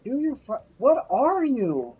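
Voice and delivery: bewildered drawl. Acted. Baffled by a strange boss.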